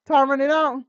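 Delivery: ordinary talk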